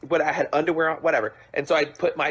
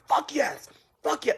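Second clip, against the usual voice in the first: retold yell; 9 semitones higher, slower than usual. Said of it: "I was, like, yelling, like, gutturally".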